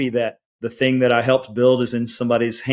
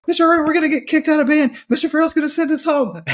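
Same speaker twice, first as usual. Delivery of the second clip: put-on much higher, fast, panicky kid voice; panicked students; 3 dB louder, faster than usual. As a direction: squeal it like terrified teenagers.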